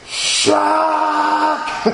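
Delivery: sudden high bark of a drill sergeant; a drill sergeant's sharp high bark. Retold or imitated. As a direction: snap it out like a sergeant catching you. Drill sergeant barking at him.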